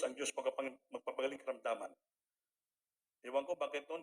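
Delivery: ordinary delivery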